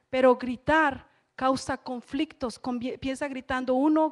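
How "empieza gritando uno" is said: usual speaking voice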